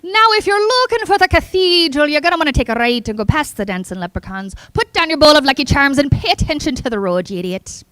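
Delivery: hammed-up Irish accent, opening high-pitched. Shown as a demonstration. GPS sending a driver past leprechauns, Irish accent. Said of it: "we have Irish".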